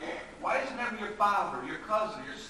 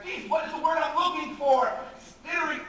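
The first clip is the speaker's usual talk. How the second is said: frantic word hunt; 8 semitones higher, 8 dB louder than usual. Acted.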